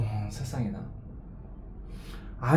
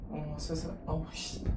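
much softer, breathy frightened gasp; breathless fear; 13 dB quieter than usual. Real, not acted. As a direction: barely get the words out, petrified. Frozen with fear of heights. (숨죽인 겁먹은 탄식)

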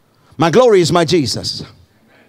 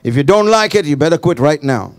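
ordinary talk

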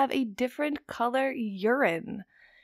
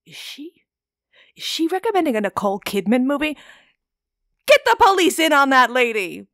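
mock-outraged intruder, building to a yell; higher, louder, hammed-up alarm ending in a shout; 4 semitones higher, 8 dB louder than usual. Imagined intruder appalled by a film recommendation, mock outrage. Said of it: "someone's gonna break into my house".

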